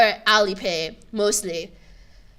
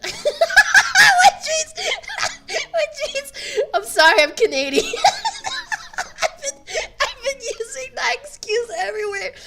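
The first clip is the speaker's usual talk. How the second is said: high, helpless laughing delivery; squealing with real laughter as you talk; laughing through words; 5 dB louder than usual. Real, not acted. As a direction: crack up while trying to explain. Cracking up at own excuse.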